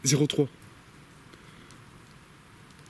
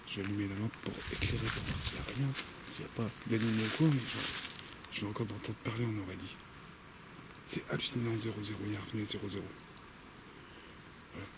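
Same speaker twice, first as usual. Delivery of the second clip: hushed, careful forest voice; speaking low; 9 dB quieter than usual. Real, not acted. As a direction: keep it down, don't disturb the woods. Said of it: "Je parle tout bas parce que j'aime pas dans une forêt parler très fort". (parler tout bas)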